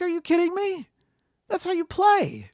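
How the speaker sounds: hammed-up disbelief at a sore loser; appalled outrage. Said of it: "I was appalled".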